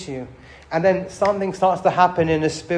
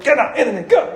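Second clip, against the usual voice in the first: mock rebuke; 5 semitones higher than usual. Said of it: "I have a pain in my foot".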